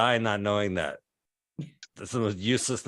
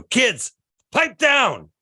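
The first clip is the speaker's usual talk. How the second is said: put-on loud, high-pitched yell at noisy kids; acted exasperated parent yelling; frustrated shout; 13 semitones higher, 7 dB louder, much slower than usual. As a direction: shout it sharply up high, fed up.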